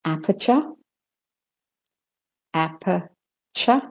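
"Aperture" with neither r said, rising; British-Australian schwas in "Aperture". Shown as a demonstration. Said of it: "the er is not said".

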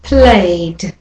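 Played, A vowel gliding into D; played with a final d. Shown as a demonstration. Teacher models played for learners. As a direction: let the A vowel run into D in Played. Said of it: "And again".

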